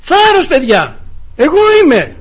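high, loud reassuring call. Retold or imitated. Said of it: "ο Ιησούς φώναξε". (δυνατό κάλεσμα με θάρρος)

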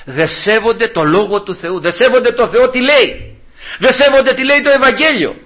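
rising pulpit insistence; emphatic preaching. Real, not acted. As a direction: build a denunciation phrase by phrase. Preacher denounces the ungodly.

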